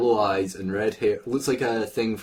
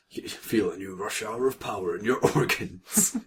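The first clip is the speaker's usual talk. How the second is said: mock advert voice; 4 dB quieter than usual.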